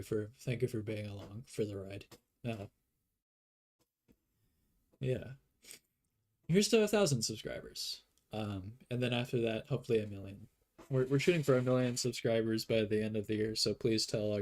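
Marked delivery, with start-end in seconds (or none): 6.5-8.0 much higher, bright celebratory toast; excited toast. Real, not acted. Toasting viewers for reaching a thousand subscribers, excited. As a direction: raise a glass to the milestone.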